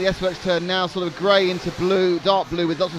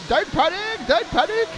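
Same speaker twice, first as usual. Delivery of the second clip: much higher, yelping Don't panic; panicked shout; 13 semitones higher, slower than usual. Pretend. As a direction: cry it out in mock alarm. Jokey cry on driver's name.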